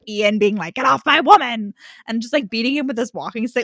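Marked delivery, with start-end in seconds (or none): 0.7-1.7 loud, cranky imitated yell; mock old-man shouting. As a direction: holler like an old man chasing someone off.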